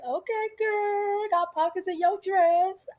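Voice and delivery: high, playful hype-up; high-pitched mock-impressed cooing; teasing admiration. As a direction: hype up your girlfriend in a high voice. Teller acts out teasing admiration.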